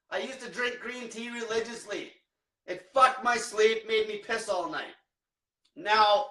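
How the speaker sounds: usual speaking voice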